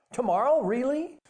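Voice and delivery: put-on high, rising child's question; hopeful surprise. A narrator's reading. Son asks father if he'll catch it tomorrow, hopeful surprise.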